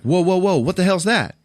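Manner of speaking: startled cry at a discovery; shocked whoa. Retold or imitated. Mira shocked by tattoo.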